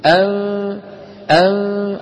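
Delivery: أن held as a long nasal hum; teacher's ghunna demo. Shown as a demonstration. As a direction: hum the نون of أن through the nose. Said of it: "لما منقول".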